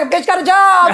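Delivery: loud, high triumphant brag; proud announcement. Retold or imitated. Bragging to the family at home, loud and triumphant. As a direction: shout the news like a win. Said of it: "I'm like".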